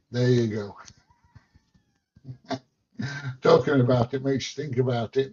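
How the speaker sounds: normal talking voice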